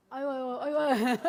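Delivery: loud laughing sighs of amusement; laughing aah. Real, not acted. Nun laughing loudly at the congregation's joke.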